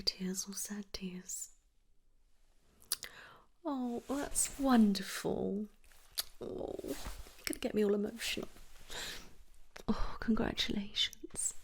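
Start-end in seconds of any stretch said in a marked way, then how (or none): 3.6-11.5 moved delight. Acted. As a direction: coo with tender joy at good news. Nurse delighted at pregnancy news.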